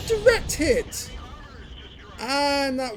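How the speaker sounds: high, loud cry of triumph; excited. Real, not acted. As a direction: call the hit like a goal. Direct hit on thin vehicle.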